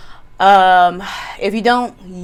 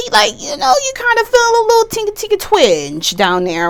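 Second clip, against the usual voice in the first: much higher, hammed-up ooh of delight; titillated oohs; 13 semitones higher, 4 dB louder than usual. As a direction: squeal it teasingly up high.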